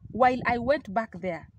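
normal talking voice

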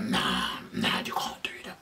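whispered nah nah you can't; hushed mock warning. Imitating the man.

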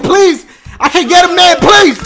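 high, loud desperate begging; mock pleading woman. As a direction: wail it like a hammed-up desperate woman. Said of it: "she's like".

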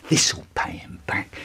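unvoiced revenge whisper; vengeful whisper. Boy whispers revenge while stabbing his brother. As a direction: hiss it through the teeth.

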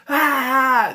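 high, loud yelp of fright; hammed-up scream of surprise; mock scream. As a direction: shriek like you've just been startled. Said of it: "And then Jonathan screams".

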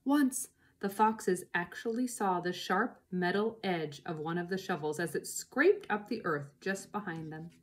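usual speaking voice

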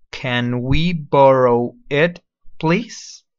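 "Can we borrow it, please?" very slow, rising on please; slow model phrase. Shown as a demonstration. Teacher slowly modeling "Can we borrow it, please?". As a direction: drag it out so they hear each word. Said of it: "Listen to the pronunciation first".

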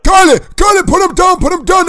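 high loud pleading yell; frantic yell. Pretend. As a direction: beg her to drop him. Pleading to drop his uncle.

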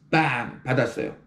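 ordinary delivery

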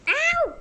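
high yelp of pain; sharp yelp. Acted. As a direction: yelp as if hurt. Stuck in the fridge.

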